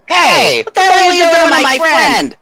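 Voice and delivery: high, crying shout of outrage; angry shout. Acted. Player character screams at the employee who cornered her friend, tearful angry shout. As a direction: yell it tearfully to defend your friend.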